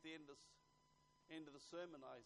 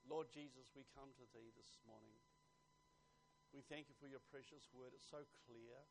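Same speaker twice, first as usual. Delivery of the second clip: measured appeal to the Lord, growing fervent; congregational prayer; 10 dB louder than usual. Real, not acted. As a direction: pray aloud, building in force.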